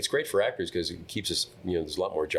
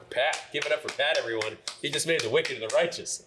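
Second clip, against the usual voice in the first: mock crowd hype, pitched up; hype man; 8 semitones higher than usual. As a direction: announce him like a showman to a crowd. Hyping up the crowd.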